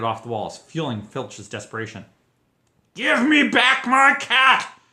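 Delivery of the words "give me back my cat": high, loud, slow furious roar. A narrator's reading. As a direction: roar it in desperation. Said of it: "he roared".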